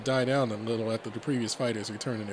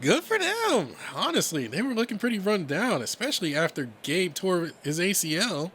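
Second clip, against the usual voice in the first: higher, animated character voice; lively, raised-pitch dialogue; chatty friend; 7 semitones higher than usual. Acted. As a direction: chat brightly about absent friends.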